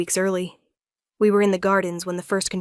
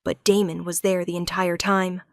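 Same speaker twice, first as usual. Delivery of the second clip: usual speaking voice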